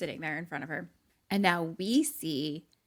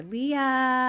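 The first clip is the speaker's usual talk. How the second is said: high, drawn-out call, louder; 7 semitones higher, 7 dB louder than usual. Retold or imitated. Mom calls Leah from the car, calling out. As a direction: call her name as if from afar. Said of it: "is calling for her".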